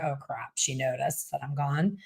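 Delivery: guilty inner thought. Retold or imitated. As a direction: mutter it as if caught out. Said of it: "They're like".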